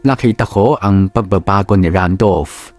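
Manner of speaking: ordinary delivery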